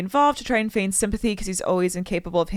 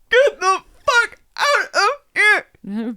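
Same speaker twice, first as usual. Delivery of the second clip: high, loud, one word at a time; hammed-up staccato angry shout; mock furious yell; 12 semitones higher, 7 dB louder, slower than usual. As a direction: repeat the order slowly, each word punched. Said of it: "he looks at me again and says".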